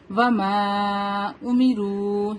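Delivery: امروا with a drawn-out final "roo"; slow chant, holding the long vowels of وما امروا; long madd. Shown as a demonstration. Teacher shows three-to-five counts, long madd. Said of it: "three to five count اس کو لمبا کریں گے".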